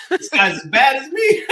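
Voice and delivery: high, loud, laughing tease; laughing mock quote. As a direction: giggle it out as a joke.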